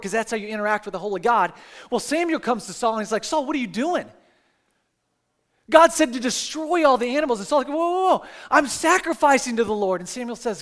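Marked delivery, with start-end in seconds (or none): 3.2-7.4 rising to a mock scolding shout; scolding Samuel. Samuel rebukes Saul in retold scene. 7.7-10.0 high, loud whoa whoa whoa; king caught out, high startled protest. Retold or imitated. Saul defends himself, retold.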